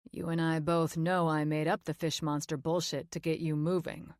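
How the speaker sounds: easy, confident guy voiced lightly; lightly voiced man. A narrator's reading. Jake's voice.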